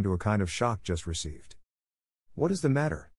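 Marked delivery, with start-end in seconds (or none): 2.3-3.1 higher-pitched curious exclamation; lightly voiced schoolgirl cry; excited cry. A narrator's reading. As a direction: call out the question, alarmed and curious. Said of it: "cried two or three voices at once".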